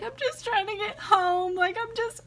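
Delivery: high, small, tearful voice; mock tearful protest; imitated crying. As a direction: whimper it softly as if crying. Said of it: "and I was just crying".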